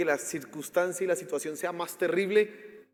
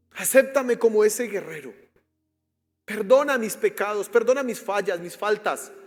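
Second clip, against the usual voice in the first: loud, higher prayer of surrender; fervent prayer; 4 semitones higher, 8 dB louder than usual. Real, not acted. Pastor leading newcomers in a prayer of surrender, loud and fervent. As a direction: pour it out as a plea.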